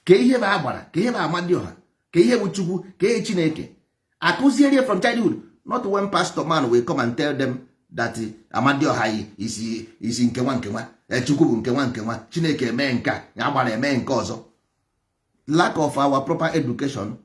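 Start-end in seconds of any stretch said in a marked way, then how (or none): none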